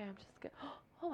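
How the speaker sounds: unvoiced, breathy whispered gasp; mock gasp. Woman retelling her overwhelm at forty-five TikToks, gasping. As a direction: gasp at the pile of videos.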